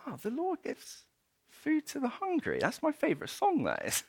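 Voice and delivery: high, soft, wondering voice; inner thought. Retold or imitated. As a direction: marvel quietly to yourself. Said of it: "he thinks to himself".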